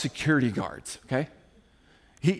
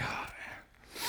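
barely audible groggy sigh; mock just-woke-up mumble, very soft; sighed out like someone acting half asleep; 19 dB quieter than usual. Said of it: "It's all groggy. He's like".

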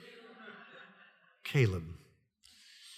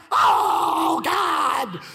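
higher, louder exasperated cry; exasperated shout; 14 semitones higher, 7 dB louder than usual. Retold or imitated. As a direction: blurt it out in exasperation. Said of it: "I'm like".

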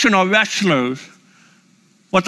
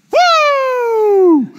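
high, loud, long wrestler's whoop; Ric Flair woo; 4 dB louder than usual. Shown as a demonstration. Preacher demonstrates Flair's sound, long high whoop. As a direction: let out a huge Flair woo. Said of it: "his sound was, went like this".

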